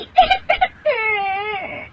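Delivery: high, drawn-out fake crying; boo-hoo theatrically; comic sob. Bahu sobbing after accident.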